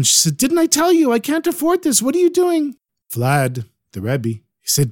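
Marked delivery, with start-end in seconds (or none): 0.4-2.8 put-on high, scolding old woman shouting at him; angry shout. Old woman shouts at the Rebbe lighting her fire. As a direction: yell at the man lighting the fire. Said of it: "she shouts at him".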